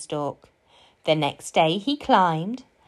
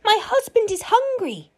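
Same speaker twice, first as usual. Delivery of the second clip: high-pitched, alarmed warning; urgent giantess; 14 semitones higher than usual. A narrator's reading. Giantess telling Jack to hide from her husband, urgent and high. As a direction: warn him urgently, up high. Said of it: "said the giantess".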